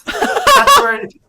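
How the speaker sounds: breaks into laughter mid-line; words squeezed out through a laugh; laughing too hard to finish. Real, not acted.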